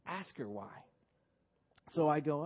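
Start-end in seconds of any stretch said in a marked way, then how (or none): 0.0-0.7 softer, low aside; mentor's soft prompt; quiet instruction. Retold or imitated. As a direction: murmur the advice. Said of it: "So my mentor said".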